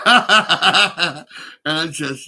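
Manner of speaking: words squeezed out through a big laugh; cracking up mid-word. Real, not acted. Speaker to listeners, laughing helplessly. Laughing while quoting NHS line.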